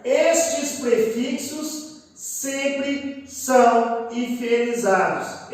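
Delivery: "estes prefixos sempre são" very slow, word by word; slow emphatic. Shown as a demonstration. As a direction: drag "estes prefixos sempre são" out to drive the rule home. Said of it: "devido a esta cadência".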